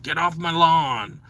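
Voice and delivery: lightly voiced cranky old-timer, slowed down; a hint of the get-off-my-lawn crank; mild mock old grouch; grumpy old person. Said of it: "old queer person who's like".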